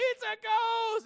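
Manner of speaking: high, loud, drawn-out scream; hammed-up shriek of fright; terrified shout. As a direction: scream it like you've seen a ghost.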